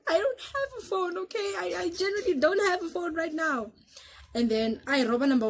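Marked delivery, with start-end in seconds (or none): 0.1-3.7 high, tearful pleading voice; frightened plea. Retold or imitated. Gun to her head, telling the drunk robber she has no phone, high tearful plea. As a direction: beg with a crying catch in the voice.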